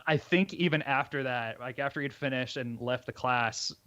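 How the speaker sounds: ordinary delivery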